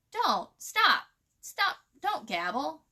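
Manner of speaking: exasperated doll telling the crow to stop; sharp interruption. A narrator's reading. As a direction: stop him mid-sentence. Miss Hickory cutting Crow off.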